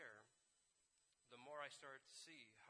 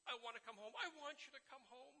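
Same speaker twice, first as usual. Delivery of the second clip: high, louder plaintive wail; imitating a tearful, high-pitched plea; mock crying; 11 semitones higher, 5 dB louder than usual. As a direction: plead as if in tears. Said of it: "in Toronto crying".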